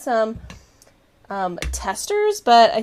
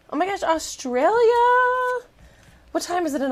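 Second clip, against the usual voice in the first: surprised delight; 9 semitones higher than usual. Real, not acted. Viewer joining from Australia.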